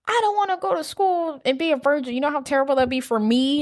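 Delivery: high-pitched, pleading imitation; mock whining. Imitating a boy pleading with his date, mock whining. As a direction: complain like a desperate teenage boy.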